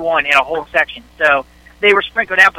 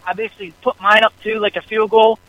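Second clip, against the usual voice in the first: ordinary delivery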